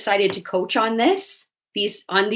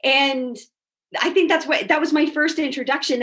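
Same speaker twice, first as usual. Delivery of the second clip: ordinary talk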